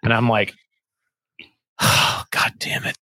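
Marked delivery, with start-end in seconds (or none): 1.8-3.0 slow whispered curse; weary whispered swear, drawn out. Retold or imitated. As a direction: breathe out a slow resigned curse. Grenade explodes above sleeping bags.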